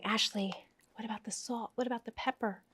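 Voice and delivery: soft imitated viewer question; hushed viewer voice. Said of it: "I know you're saying".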